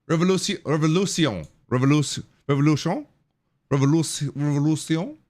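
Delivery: repeated halting tries at Spanish "Revolution"; failed Spanish pronunciation. Real, not acted. Streamer practising a Spanish word, struggling with the accent. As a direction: keep retrying "Revolution" with an uncertain Spanish accent. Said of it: "I can't do it".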